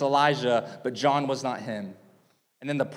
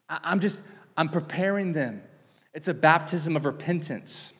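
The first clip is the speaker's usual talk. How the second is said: ordinary talk, nothing marked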